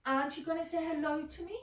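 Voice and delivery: high, quick little goblin; chirpy high creature asking fast; tiny goblin greets him quickly. Acted. Goblin voiced in story.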